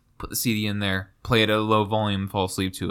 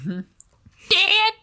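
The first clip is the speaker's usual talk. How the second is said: very high put-on cartoon kid voice; high-pitched cry for Dad, hammed up; Bobby. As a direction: squeal it like a mock cartoon son. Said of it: "With, with the Bobby".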